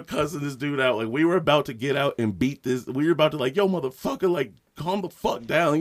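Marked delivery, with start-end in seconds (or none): 3.6-5.8 angry. Retold or imitated. Both cussing out the dude.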